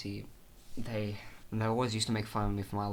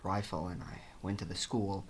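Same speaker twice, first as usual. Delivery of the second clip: softer, low dramatic telling; mock grim confession; 4 semitones lower, 7 dB quieter than usual. Patient confessing to therapist, soft grim tone. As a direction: tell it like a hushed dark secret. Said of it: "dark confession".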